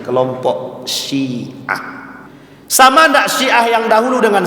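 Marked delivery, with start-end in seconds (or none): 2.6-4.4 high-pitched challenge to the listeners; forceful rhetorical question. Real, not acted. Preacher asking congregation about Shia then and now, challenging. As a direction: throw the question out high, then let it fall.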